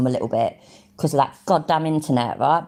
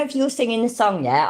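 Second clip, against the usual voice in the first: ordinary delivery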